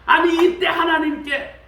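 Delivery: ordinary talk, nothing marked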